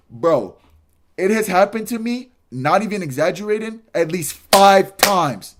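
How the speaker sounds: pressing the point loudly; emphatic rant. Real, not acted. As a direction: lean hard on it, slowing at the end. Emphatic rant about persistent women.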